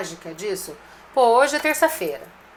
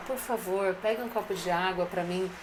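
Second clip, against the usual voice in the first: softer, courteous asking; how the producer wishes the boss had asked, softer and polite; polite request; 4 semitones lower, 8 dB quieter than usual. Retold or imitated. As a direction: ask nicely, softer, saying please. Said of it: "Não poderia ter dito".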